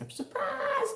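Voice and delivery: very high, sing-out surprise; playful high-pitched exclamation. Shown as a demonstration. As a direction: pop it out high and drawn out. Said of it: "I always just love saying".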